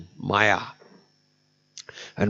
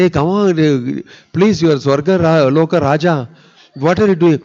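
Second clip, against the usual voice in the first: raised, urgent pleading, much higher and louder; pleading call; 6 semitones higher, 6 dB louder than usual. Acted. Devas calling out to pig Indra, loud urgent pleading. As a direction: beg him loudly to snap out of it.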